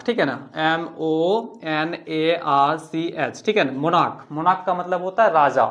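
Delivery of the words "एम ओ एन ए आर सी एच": drawn-out classroom spelling; slow spelling. Shown as a demonstration. Teacher writing stomach on board, spelling slowly. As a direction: spell stomach out one letter at a time, very slowly.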